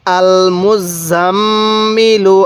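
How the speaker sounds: "আল মুজাম্মিলু" very slow, double m hummed long and level; ghunnah. Shown as a demonstration. Teacher modelling the whole word for learners, long nasal hold. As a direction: hold the nasal mm in "আল মুজাম্মিলু", then release into "ilu". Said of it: "কিভাবে পড়ব?".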